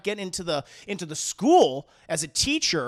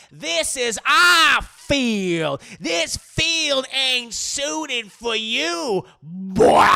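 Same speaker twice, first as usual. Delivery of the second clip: loud, slow drawn-out taunt, higher; mock taunting shout; 6 semitones higher, 8 dB louder, much slower than usual. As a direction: bellow the taunt at him slowly. Racist players taunting, shouted.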